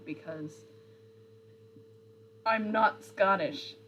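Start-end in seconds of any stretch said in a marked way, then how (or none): 2.4-3.8 slow, louder, a hint of Scottish; lightly Scottish-sounding denial; mock Scottish accent. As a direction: protest in a light Scottish lilt. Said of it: "like, Scottish".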